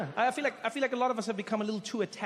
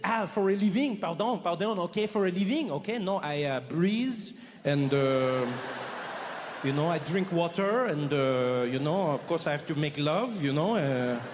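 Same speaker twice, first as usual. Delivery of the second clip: imitating a carefree Frenchman, lower and unhurried; a Frenchman explaining his leisurely life, mock French accent; 3 semitones lower than usual. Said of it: "You go up to a French person".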